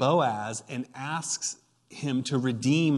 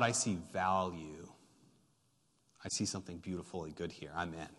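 much softer, lower and gentle; tender quiet resolve; 6 semitones lower, 10 dB quieter than usual. Acted. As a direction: say it softly, as a gift. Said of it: "And Boaz says".

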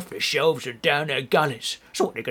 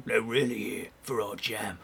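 normal talking voice

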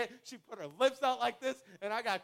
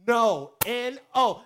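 spelled-out loud no; 9 dB louder, slower than usual. Retold or imitated. Friend insisting he refuse.

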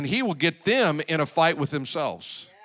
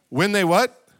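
prompting question; 4 semitones higher, 5 dB louder than usual. Acted. Preacher prompts congregation to answer.

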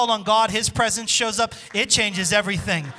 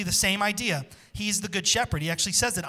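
normal talking voice

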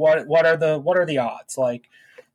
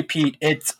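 ordinary talk

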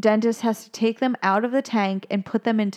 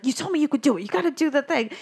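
pufferfish voice; 5 semitones higher, much faster than usual. Retold or imitated. Pufferfish urging Jacques.

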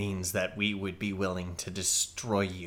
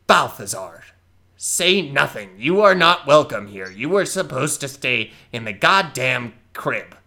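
scolding elder; 4 semitones higher, 10 dB louder than usual. Acted. Elder scolds young goblin.

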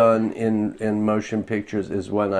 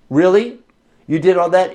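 higher, louder, impressed question; mock amazement; 7 semitones higher, 6 dB louder than usual. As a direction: act out the producer's amazement. Retold producer's amazed reply.

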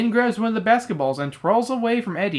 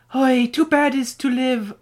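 high, slow stage Irish; put-on Irish accent; 6 semitones higher, slower than usual. Mister O'Grady answering Eddie, slow Irish accent. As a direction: drawl it in a playful Irish accent. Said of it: "I like your Irish accent there".

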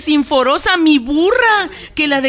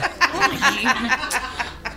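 soft laugh; 2 semitones lower, 6 dB quieter than usual. Acted. Remedios laughs at donkey ears joke.

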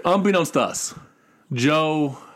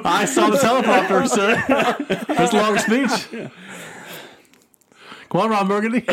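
laughing; 5 semitones higher than usual. Real, not acted. Teasing Joe about his speech.

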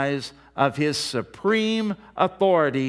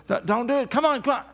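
higher, pleading strain as the grip slips; straining rescuer; 8 semitones higher, much faster than usual. Retold or imitated. Preacher acting out a cop losing his grip: higher, pleading strain. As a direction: play the cop losing his hold, begging.

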